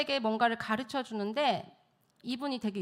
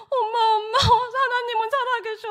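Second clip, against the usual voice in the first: higher and louder, imitating a sobbing son; tearful cry; 13 semitones higher, 8 dB louder than usual. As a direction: call out to mom through tears, much higher. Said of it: "울먹거리면서 전화를 한 거예요".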